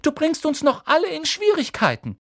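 put-on high anxious scolding girl; bossy Hermione. Hermione scolding Harry anxiously, high voice. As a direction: warn him, fretting.

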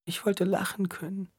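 high tearful whisper. A narrator's reading. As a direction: whisper through tears. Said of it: "flüsterte sie".

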